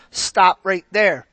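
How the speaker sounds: ordinary talk, nothing marked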